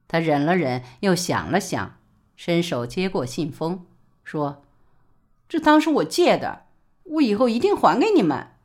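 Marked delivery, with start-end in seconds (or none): 5.5-8.6 higher woman's voice, quick resolute promise; Li Baoli's voice. A narrator's reading. Li Baoli to her in-laws, higher and resolute. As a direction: swallow tears and promise to repay.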